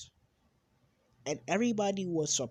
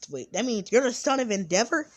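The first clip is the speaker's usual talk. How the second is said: high, loud disbelieving cry; shocked shout; 8 semitones higher, 12 dB louder than usual. Retold or imitated. Classmate learns his friend is Endeavor's son, shocked shout. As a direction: blurt it out in total shock. Shocked reveal in retold scene.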